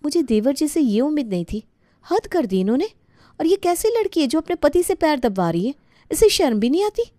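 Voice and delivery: mock high indignant scolding; wife outraged by the photo, high and indignant.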